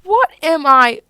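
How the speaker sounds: loud, high indignant cry; loud, high offended cry; indignant outburst. Acted. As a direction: scoff loudly at the idea. Indignant outburst about the car.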